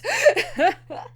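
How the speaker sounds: high delighted cackle; burst of laughter. Real, not acted. Fan cackling at the anime scene, delighted. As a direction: laugh out loud at the screen.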